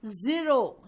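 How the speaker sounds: loud "ज़ीरो" with buzzed z; emphatic model of "ज़ीरो"; zee sound shown. Shown as a demonstration. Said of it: "जी आ रहा है".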